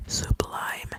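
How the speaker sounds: ordinary talk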